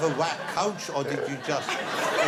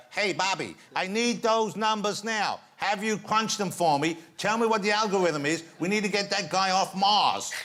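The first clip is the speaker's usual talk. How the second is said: higher-pitched, urgent mock-American role voice; NASA colleague; 6 semitones higher, 4 dB louder than usual. Host playing NASA woman to guest, hammed-up American urgency. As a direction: ham up a slow, emphatic American demand. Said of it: "I'm nasa Woman three".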